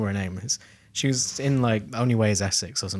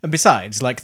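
ordinary talk

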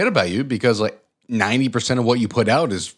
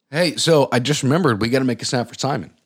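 ordinary talk, nothing marked

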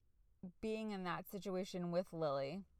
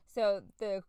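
usual speaking voice

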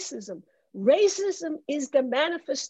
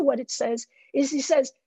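ordinary delivery

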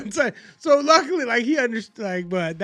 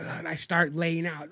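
usual speaking voice

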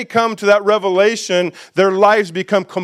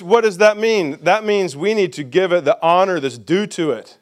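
usual speaking voice

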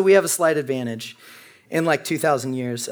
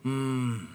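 approving hum; 2 semitones lower, 7 dB quieter than usual. Retold or imitated. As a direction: hum like you agree. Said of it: "and then people go".

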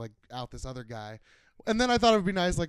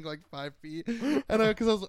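normal talking voice

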